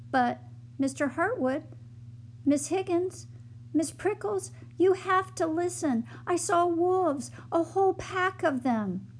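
high, pressing plea; urgent pleading. Acted. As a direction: beg them to listen, voice climbing.

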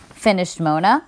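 ordinary delivery, nothing marked

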